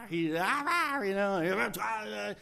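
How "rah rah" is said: loud growling tearing noise; imitated savage roar. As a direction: roar through a mock fight. Imitating Samson tearing the lion.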